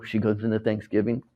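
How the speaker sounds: ordinary talk, nothing marked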